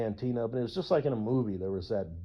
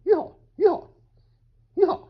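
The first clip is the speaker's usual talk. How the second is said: loud high rodeo whoop; cowboy yeehaw; 9 dB louder than usual. Pretend. After "cowboy shit", loud high yeehaw whoop. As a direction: holler yeehaw with gusto.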